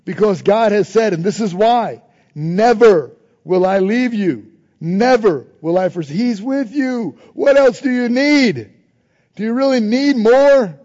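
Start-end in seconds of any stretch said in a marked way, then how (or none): 2.4-10.8 forceful preaching. Acted. Preacher to congregation, forceful proclamation. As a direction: preach it out, slow and loud.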